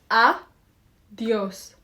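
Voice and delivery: "Adios" split as A, pause, dios; clapped syllables. Shown as a demonstration. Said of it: "Let's clap the syllable".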